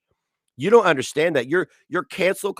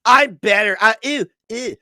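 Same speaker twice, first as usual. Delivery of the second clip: higher, louder prissy mock voice; mocking Nova; 8 semitones higher, 8 dB louder than usual. Mocking Nova's Twitter posts in a high, disgusted voice. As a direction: sneer it out like a snooty rival. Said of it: "She has to go to Twitter and be like".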